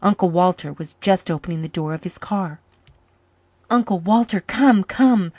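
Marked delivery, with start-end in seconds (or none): 3.7-5.3 put-on high, loud, pleading child's sob; sobbing. Boy sobbing to his uncle to come quick. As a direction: beg him to come through tears. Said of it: "sobbed Jims".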